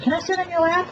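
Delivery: put-on very high, squeaky girly voice; mock bimbo stripper. Mocking the second stripper asking for his lap, squeaky high.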